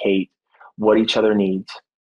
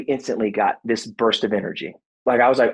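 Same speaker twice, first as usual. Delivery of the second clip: usual speaking voice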